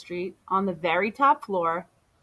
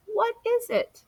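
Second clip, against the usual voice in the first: much higher put-on voice; hot dog vendor; 12 semitones higher than usual. Hot dog vendor asking Amu about the smell, high voice. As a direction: ask in a funny vendor voice.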